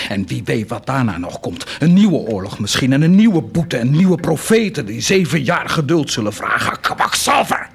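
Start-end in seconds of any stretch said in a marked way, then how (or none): 1.8-6.8 building to a loud, higher angry outburst; loud and heated, pushing each phrase harder; rising anger. Acted. Angry tirade against Heinrich's patience. 6.8-7.7 insulting shout. Acted. Hurls insult at the end of the tirade.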